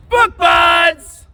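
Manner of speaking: loud, high channel shout-out; announcer shout. Acted. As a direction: belt out the show name.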